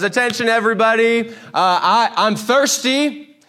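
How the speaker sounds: broadcast it like a loudspeaker call to the whole cave; mock public announcement. Preacher mocking David announcing his thirst to everyone. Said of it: "It's not like David says".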